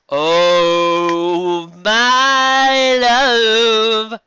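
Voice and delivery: high, loud, swooning sigh of love; swooning lover. Acted. Girl falling for the boy, hammed-up loud swoon. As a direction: swoon over him theatrically.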